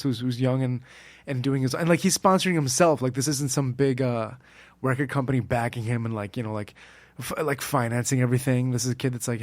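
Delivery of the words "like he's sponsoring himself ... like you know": usual speaking voice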